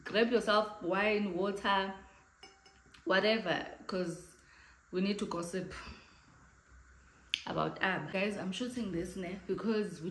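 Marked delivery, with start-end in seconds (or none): none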